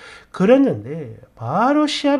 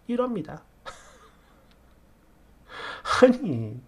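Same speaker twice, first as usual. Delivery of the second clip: ordinary delivery, nothing marked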